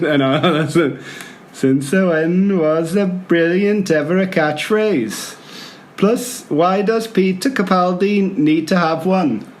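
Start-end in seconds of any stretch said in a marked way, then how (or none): 1.5-9.5 put-on slow, stretched-out Welsh accent to start; careful mock Welsh accent. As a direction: read it in a put-on Welsh lilt. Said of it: "I'm gonna do Welsh".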